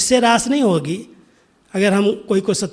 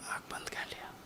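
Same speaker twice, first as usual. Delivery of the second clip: whisper; 24 dB quieter than usual. Retold or imitated. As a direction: sink to a bare whisper. Dadi closing eyes, acted out. (फुसफुसाकर)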